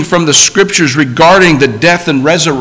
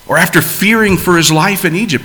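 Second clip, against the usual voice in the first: ordinary talk, nothing marked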